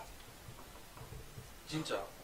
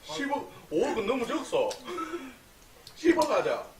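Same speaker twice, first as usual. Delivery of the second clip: Gyeongsang dialect; 11 semitones higher than usual. Retold or imitated. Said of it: "이거 경상도 말이야".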